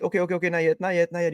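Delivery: mock panic as the fish nears the net; panicking angler. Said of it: "he's panicking a bit".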